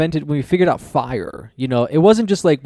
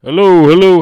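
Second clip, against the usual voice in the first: loud call, pitched higher; 5 semitones higher, 11 dB louder than usual. Pretend. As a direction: shout it into the dark. Calling into a pretend cave.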